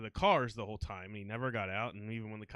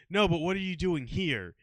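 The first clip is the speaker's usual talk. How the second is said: insistent cop questioning; 7 semitones higher, 8 dB louder than usual. Retold or imitated.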